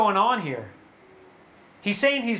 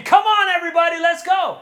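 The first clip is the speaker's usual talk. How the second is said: high, loud call to a crowd; rallying call; 6 semitones higher, 4 dB louder than usual. Acted. Psalmist up front leading the people in praise, rallying call. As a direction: rouse everyone from the front. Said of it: "I was up in the front saying".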